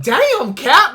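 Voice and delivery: high, loud, drawn-out disbelief; retold amazed exclamation. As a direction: exclaim it, amused and astonished. Retold amazed reaction to friend's headbutt.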